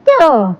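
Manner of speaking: "ट" as a drawn-out t plus short vowel; letter sound demo. Shown as a demonstration. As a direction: sound out "ट" for learners. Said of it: "T की sound होती है".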